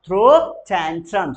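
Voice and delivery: slow loud drill; very slow repeat. Shown as a demonstration. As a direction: stretch it out for the class. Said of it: "बोलेंगे जरा".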